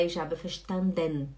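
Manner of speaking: "verstanden" as fer-shtan-DEN, E clearly said; full final E. Shown as a demonstration. Teacher recapping what to avoid, fully sounded ending. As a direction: finish "verstanden" on a distinct DEN. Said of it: "Em vez de falar".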